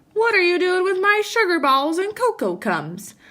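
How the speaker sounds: hammed-up Papa shout; mild shout. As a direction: protest over his sugar balls. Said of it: "shouted Papa".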